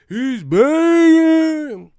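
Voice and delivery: very high, slow, loud ghostly wail. Retold or imitated. Mimicking Bradley like a ghost, very high slow wail. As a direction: moan it like a ghost. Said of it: "sound like a ghost".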